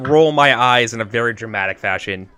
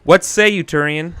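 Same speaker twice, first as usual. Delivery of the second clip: formal challenge; 6 semitones higher, 6 dB louder than usual. Acted.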